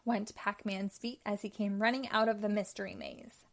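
ordinary delivery

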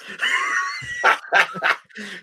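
shrieking high laugh; real piercing cackle. Real, not acted. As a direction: crack up. Laughs at own joke.

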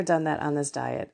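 ordinary talk

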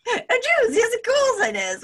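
very high, loud mock-posh accent; hammed-up high posh voice; really posh accent. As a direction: imitate someone being really posh. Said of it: "you also went really posh".

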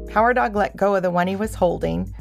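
plain reading voice

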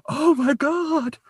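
high, drawn-out horrified gasp of words; hammed-up horror, small and high-pitched; little mock shriek. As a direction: imitate a shocked daughter, squeaky and stretched. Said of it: "I love your little, like".

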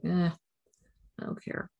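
breathy, uncaring shrug of a whisper; apathetic whisper. Retold or imitated. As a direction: whisper it like nothing matters. Mimics her own apathy.

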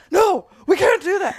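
high, urgent mock protest; panicked refusal. Shopper begging partner not to question staff, panicked refusal. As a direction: protest as if dreading a confrontation.

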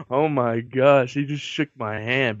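slow, starstruck hush; awestruck. Retold or imitated. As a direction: gasp it out in awe. Awed recruit after handshake from a god.

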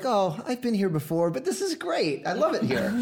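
retold delight, pitched well up; imitating wife's delight. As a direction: brightly act out her pleased reaction, high voice. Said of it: "so at first she was like".

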